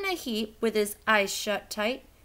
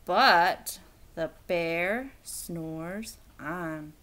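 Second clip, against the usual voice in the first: slow refrain; 7 semitones lower, much slower than usual. A narrator's reading. Reading the refrain to the listener, slow. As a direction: let it settle, slowly.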